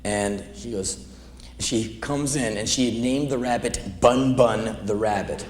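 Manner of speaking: ordinary talk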